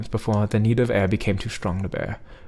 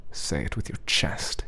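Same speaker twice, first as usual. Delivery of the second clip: hushed command; 6 semitones lower, 6 dB quieter, slower than usual. A narrator's reading.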